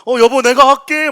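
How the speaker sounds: high, booming shout to a spouse; loud calling out. Acted. Husband calls out to his wife, loud shout. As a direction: holler it out big and high. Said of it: "막 큰 소리 막 치지 않습니까".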